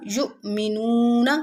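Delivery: युमिनूना slow, नू held a little long; stretched नू. Shown as a demonstration. Teacher demonstrating madd on वाव in युमिनूना. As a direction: draw out the नू gently. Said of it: "वाव मद्दा को थोड़ा सा खींच के".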